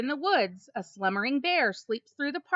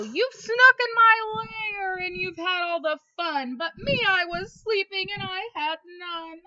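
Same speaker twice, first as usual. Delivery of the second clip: higher, mock tearful bear voice; whiny bear; 7 semitones higher than usual. Bear complaining to party guests, mock crying whine. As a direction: whine like a sulky big bear.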